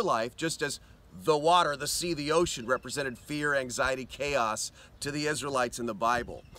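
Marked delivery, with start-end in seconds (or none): none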